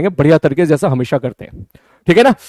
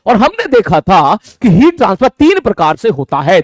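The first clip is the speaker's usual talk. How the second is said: ordinary talk, nothing marked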